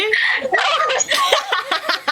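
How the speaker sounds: high-pitched laughing burst. Real, not acted. Friend laughs hard at the silly argument, high laugh. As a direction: break into a squealing laugh.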